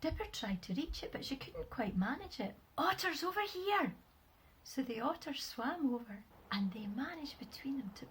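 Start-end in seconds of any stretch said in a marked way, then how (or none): 2.8-4.0 high, sustained call. A narrator's reading. Dipper finds star under rock, calling out. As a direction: summon helpers from a distance. Dipper calls otters over.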